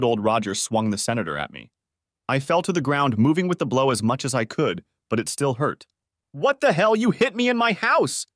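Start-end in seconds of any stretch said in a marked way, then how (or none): 6.3-8.3 high, loud outburst; outraged yell after being hit; said loudly. A narrator's reading. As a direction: cry it out in shock and anger. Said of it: "I said loudly".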